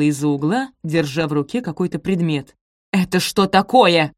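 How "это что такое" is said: slow, loud angry demand; angry roar. A narrator's reading. Stepmother holds out the shoe to Sophie, roaring. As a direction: roar it out, drawn out. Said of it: "рявкнула она".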